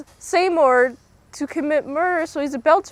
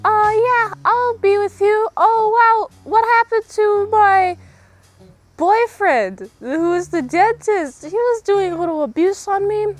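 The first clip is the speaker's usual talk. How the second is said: put-on much higher, a little louder sweet girly voice; a ditzy blonde fretting over her boyfriend; high, sugary damsel voice; sweet voice lady; 10 semitones higher, 4 dB louder than usual. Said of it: "I'm actually cosplaying her right now".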